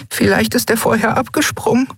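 very high, small character voice; young character's voice. Acted. Companion hoping the lost friend jumped clear, in a high little voice. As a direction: offer a hopeful guess in a high-pitched voice. Narrator voices Nelen offering hope.

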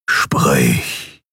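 low, distorted dark overlord; deep, distorted imperious voice; Schattenkaiser. A narrator's reading. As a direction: command like a sinister masked ruler. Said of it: "die verzerrte Stimme des Schattenkaisers".

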